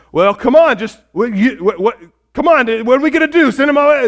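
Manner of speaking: imitated worry. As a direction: act worried, tripping into a fast rush. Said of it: "kinda being so worried about it".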